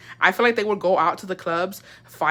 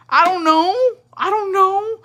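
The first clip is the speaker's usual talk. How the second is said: high, louder mock wail; imitated crying child; 12 semitones higher, 5 dB louder, slower than usual. Child answering mom who scolds her for crying, mock tearful. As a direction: whine it like a tearful little kid. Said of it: "got mad at me for crying".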